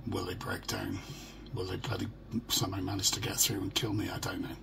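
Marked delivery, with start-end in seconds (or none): none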